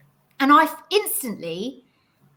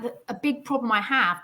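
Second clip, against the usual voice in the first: ordinary delivery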